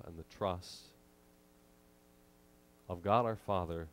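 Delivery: ordinary talk, nothing marked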